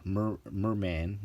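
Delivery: usual speaking voice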